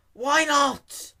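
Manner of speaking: high, loud bewildered question; puzzled. A narrator's reading. Dash puzzled by the clerk, high loud disbelief. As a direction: squeak it out in disbelief. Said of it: "Dash asked with a puzzled look".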